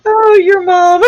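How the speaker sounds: laughing hard. Real, not acted. Laughing at crude chat.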